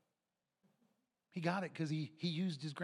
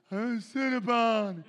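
put-on high, slow, loud pleading child voice; child whining for a treat; whiny kid; 5 semitones higher, 11 dB louder, much slower than usual. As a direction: drag it out like a begging little boy. Kid begging for Cinnabon.

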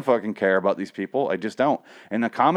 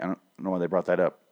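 ordinary delivery

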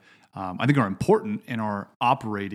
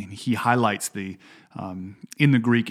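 usual speaking voice

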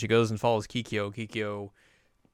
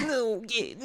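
put-on scared kid cry; 12 semitones higher, 4 dB quieter than usual. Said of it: "And she's like".

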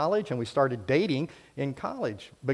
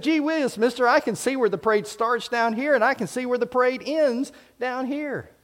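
put-on wholesome 1950s kid; 7 semitones higher than usual. Boy voiced in preacher's story.

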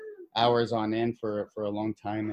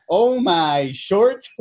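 slow drawn-out high exclamation, louder; oh my shorts; 7 semitones higher, 7 dB louder, much slower than usual. Retold or imitated. Retelling a student's greeting, a slow drawn-out mock shock. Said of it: "I'm like".

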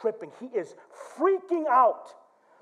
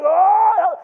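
loud, high drawn-out yell; re-enacted full-voice yell; imitated yell; 11 semitones higher, 12 dB louder than usual. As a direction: holler a long oh. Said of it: "I'm yelling on the car".

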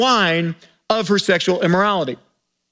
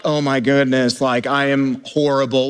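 much lower, hammed-up self-reproach; low, lightly overdone mock despair; mock guilt-ridden; 9 semitones lower than usual. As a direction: parody someone ashamed of their blessings. Mocking guilty rich believer.

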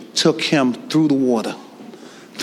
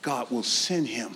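hushed preaching; 9 dB quieter than usual. Real, not acted. Drop after the climax.

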